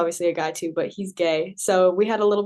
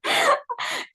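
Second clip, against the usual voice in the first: laughing. Real, not acted. Laughing after the imitation.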